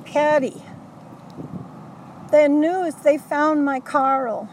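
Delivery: put-on high, loud, elated exclamation in a Brooklyn accent; imitating joyful friend. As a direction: cry out good news like Edith Bunker. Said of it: "she's got the biggest smile on her face".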